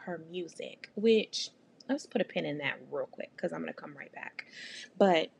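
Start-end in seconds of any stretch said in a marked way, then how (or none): 1.9-4.5 quick hushed whisper to the viewers; whispered aside. Real, not acted. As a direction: drop to a secret whisper for a side note.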